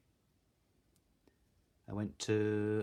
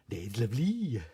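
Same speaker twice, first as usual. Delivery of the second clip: louder Welsh valleys lilt; welsh valleys accent; 5 dB louder than usual. Shown as a demonstration. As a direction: say it like a proud Valleys local. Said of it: "as they say in Wales".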